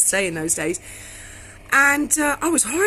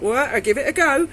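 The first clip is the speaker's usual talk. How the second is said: bold thought; 5 semitones higher, 5 dB louder than usual. Retold or imitated. Inner thought facing tough man.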